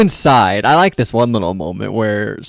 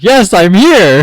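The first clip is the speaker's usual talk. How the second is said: slow, louder, mock-grand entrance; mock heroic Oz; 7 semitones higher, 6 dB louder, slower than usual. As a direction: announce it slowly like a hero. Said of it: "and he's like".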